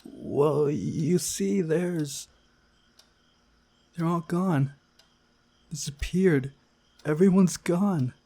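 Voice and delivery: put-on high, upset girl blurting out the news; tearful girl's voice. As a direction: voice a panicked girl near tears.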